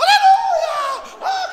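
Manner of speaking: very high, long, loud whoop; mock the crowd's drawn-out yell; congregation yelling. Church crowd when the lights go out, mock yelling. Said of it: "começa a gritar mais alto".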